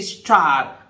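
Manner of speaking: स्टार with the final r silent, like "स्टा"; British pronunciation. Shown as a demonstration. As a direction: say स्टार and drop the r at the end. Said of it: "अंत में इसको नहीं पढ़ेंगे स्टार". (स्टार को अंत के र के बिना बोलें)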